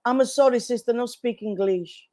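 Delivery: lightly put-on Italian-accented broken English. Mother feigning no English to the nun, light Italian accent.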